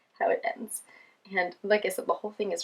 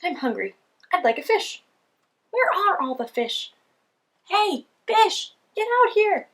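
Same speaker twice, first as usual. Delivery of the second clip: grumpy penguin voice; 11 semitones higher, 7 dB louder than usual. Acted. Hungry penguin calls for fish.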